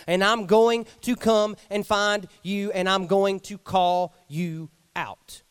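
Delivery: loud, fervent preaching. Acted. Preacher voicing God coming into the cave to find you, loud and fervent. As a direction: declare it with full force.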